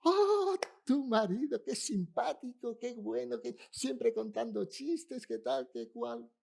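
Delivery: high, slow, gushing praise; gushing shopkeeper. Retold or imitated. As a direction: lightly play the fawning poultry seller. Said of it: "Llegamos a la pollería".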